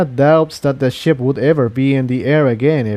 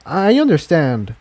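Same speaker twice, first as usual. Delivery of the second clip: lightly voiced gentle pony; 7 semitones higher than usual. A narrator's reading. Mare reassuring her friend, lightly voiced. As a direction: voice the mare kindly.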